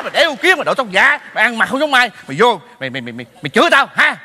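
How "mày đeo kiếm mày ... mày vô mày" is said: ordinary delivery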